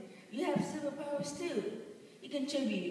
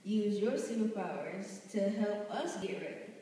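ordinary delivery

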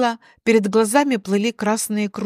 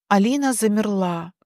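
ordinary delivery, nothing marked

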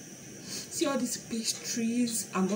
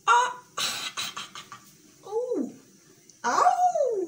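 pained yelps; 4 dB louder, much slower than usual. Real, not acted. Eyes stung by onion.

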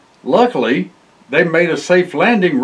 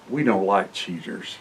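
quiet disapproval; 9 semitones lower, 9 dB quieter than usual. Real, not acted. Aside disapproving cheaters.

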